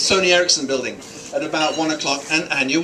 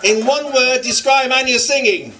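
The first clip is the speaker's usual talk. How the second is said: higher-pitched call to a crowd; 6 semitones higher, 3 dB louder than usual. Real, not acted. Speaker prompts guests to describe Anya's singing, calling out. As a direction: call the question out to the whole room.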